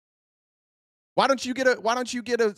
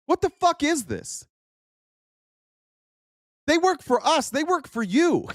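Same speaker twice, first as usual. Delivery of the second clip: pitched way up in outrage; angry outburst; 9 semitones higher than usual. Real, not acted. Streamer ranting at maga chat about the hearing: high outraged yell. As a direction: let the outrage crack higher.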